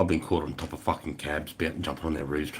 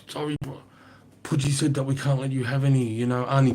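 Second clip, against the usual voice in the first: higher, sheepish imitation of the bikies; apologetic bikie; 7 semitones higher than usual. Bikies refusing him drugs, higher sheepish imitation. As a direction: play the sorry bikie letting him down gently. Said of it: "They're like".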